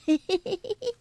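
high cheeky giggle; sly snicker. A narrator's reading. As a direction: snicker like a little troublemaker. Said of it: "坏笑着".

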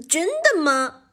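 high, exaggerated mock astonishment; feigned surprise. A narrator's reading. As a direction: act amazed, not meaning it. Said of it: "臭鼬吉米假装吃惊地问道". (假装吃惊地问)

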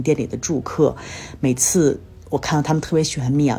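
normal talking voice

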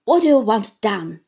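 loud, sullen challenge; ferocious Uriah. Acted. Uriah challenges Copperfield, loud and ferocious. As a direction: throw the question back. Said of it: "with a ferocious look".

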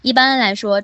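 ordinary talk, nothing marked

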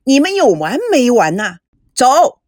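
loud, rough command; angry soldier shouting go; soldier barking orders. Acted. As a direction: storm in and order him out.

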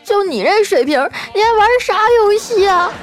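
put-on very high-pitched bratty kid voice; resentful child. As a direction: jeer like a furious little boy. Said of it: "恨恨地看着我说".

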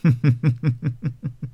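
a short cold sneer of a laugh. A narrator's reading. Fengjie laughs coldly at Wang'er's denial, a sneer. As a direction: sneer at his lie with a laugh. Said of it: "凤姐儿冷笑道".

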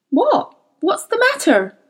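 high-pitched startled question; alarmed questioning. A narrator's reading. Boy alarmed by sobbing friend.

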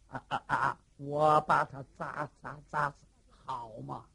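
low, halting dim-witted giant; stammering simpleton. Acted. (结巴憨傻的李元霸，声音低沉)

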